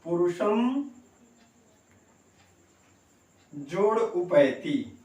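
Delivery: पुरुषम then a long pause, then उपैति, very slowly; word split shown. Shown as a demonstration. Teacher splits the joined words for students, slow and deliberate. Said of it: "मैं बताना चाहूंगा".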